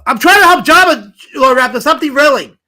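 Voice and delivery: loud, high-pitched protest; exasperated scolding. Real, not acted. Streamer telling Lord Raptor to stop derailing, exasperated shout. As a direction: raise your voice at the hecklers.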